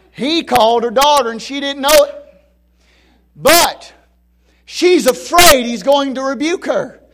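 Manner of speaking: loud, high, stretched preaching cry; preaching at full force. Acted. Preacher crying out to the congregation, loud and high.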